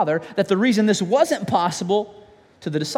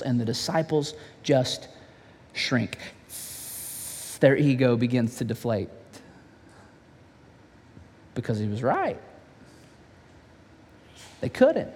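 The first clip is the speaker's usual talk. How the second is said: hushed; 8 semitones lower, 6 dB quieter than usual. A narrator's reading. Disciples shrink, hushed narration.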